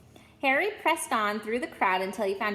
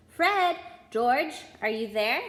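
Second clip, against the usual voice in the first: mother calling; 5 dB louder, slower than usual. Acted. Mother calls her twins from the platform, loud. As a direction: call your sons from outside.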